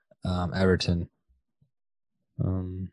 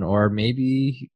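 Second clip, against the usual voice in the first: ordinary talk, nothing marked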